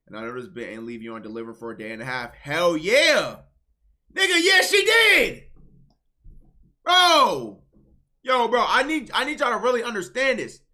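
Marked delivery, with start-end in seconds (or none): 2.3-3.4 loud, punchy hype shout; hyped yell. Real, not acted. Streamer hyped by a chat story, loud cheer. 4.1-5.4 high, slow, shouted cry; full shout. Real, not acted. Streamer shouting in disbelief at chat's love story, full shout. 6.8-10.4 higher, louder plea to the chat; loud pleading. Real, not acted. Streamer begging chat to understand the story, loud pleading.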